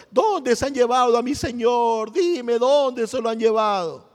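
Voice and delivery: slow, pleading voice of a grieving woman; a touch of tears in a slow plea; mild weeping plea. Retold or imitated. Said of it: "lloraba y decía". (súplica lenta con un leve llanto)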